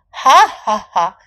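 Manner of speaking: loud, slow ha-ha-ha; scornful storybook laugh, each ha separate; elephant's mock laugh. A narrator's reading. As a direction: laugh loudly and deliberately at a small rival. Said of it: "大象哈哈哈地笑了起来".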